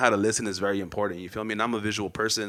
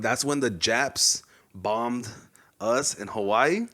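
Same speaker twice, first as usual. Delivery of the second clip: slow retold answer; much slower than usual. Retelling his slow class answer.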